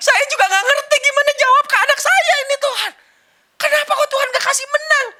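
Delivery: high, loud tearful crying to God; mother crying. Retold or imitated. Mother weeping to God after her daughter loses, high and loud. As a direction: cry it out, lost for answers. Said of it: "gantian mamanya nangis".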